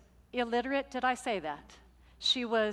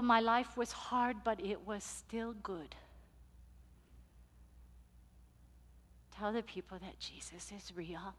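much softer, near whisper; hushed solemn message; 3 semitones lower, 11 dB quieter than usual. Retold or imitated. Luisa sends her message to the congregation, hushed and solemn. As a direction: deliver her last words reverently.

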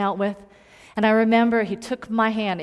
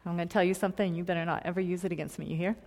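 ordinary talk

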